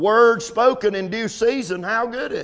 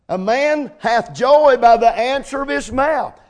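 slow, louder proclaiming; preaching scripture; 4 semitones higher, 5 dB louder than usual. Acted. Preacher reading the verse to the congregation, slow and proclaiming. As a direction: ring out each word of the verse. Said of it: "preacher proclaiming scripture".

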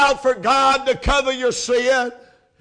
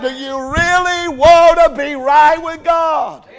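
high, loud, pressing shout; preacher shouting the question from the pulpit; 4 semitones higher, 6 dB louder than usual. Acted. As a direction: hammer the question at the congregation. Same question shouted again.

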